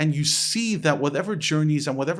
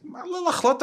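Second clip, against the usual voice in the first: much higher, incredulous repeat; high-pitched exasperated protest; indignant echo; faster than usual. Retold or imitated. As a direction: throw the words back in disbelief. Indignantly throws the advice back.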